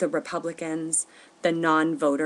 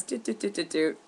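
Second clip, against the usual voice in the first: soft imitated keypad beeps; phone dial tones; 2 semitones higher, 5 dB quieter than usual. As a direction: beep out the dialing. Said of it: "he fumbles with the numbers".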